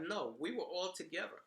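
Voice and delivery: normal talking voice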